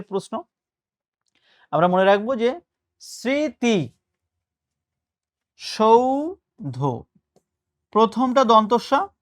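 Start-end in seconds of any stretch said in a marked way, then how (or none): 3.0-7.0 স্মৃতি সৌধ very slowly, "sriti ... shou-dho"; s in স্মৃতি, then sh in সৌধ, drawn apart; syllable-by-syllable pronunciation. Shown as a demonstration. Teacher showing dental then palatal s, slow স্মৃতি সৌধ. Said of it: "প্রমিত উচ্চারণ কোনটি".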